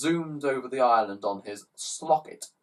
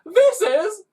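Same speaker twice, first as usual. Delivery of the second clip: put-on wailing child; 11 dB louder than usual. Child before bursting into tears.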